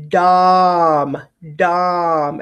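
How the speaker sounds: dom with a full open o; spelling pronunciation. Shown as a demonstration. Teacher isolating the syllable for spelling. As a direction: say dom, dom crisp and clear. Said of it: "I want you to say to spell king".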